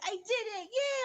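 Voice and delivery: high, squealed mock-child triumph; excited child imitation. Daughter tells mom she solved it, gleefully excited. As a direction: cheer like a little kid who finally managed it. Child celebrates success in mother's story.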